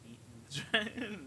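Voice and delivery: laughing at your own dirty slip; breaking into laughter. Real, not acted. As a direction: laugh bursts through mid-phrase, pitch jumping. Cracks up at own innuendo.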